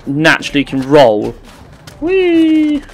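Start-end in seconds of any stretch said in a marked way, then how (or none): 2.0-2.8 high playful whoops; gleeful yelling. Real, not acted. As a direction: squeal it out with glee. Gleeful yells while rolling.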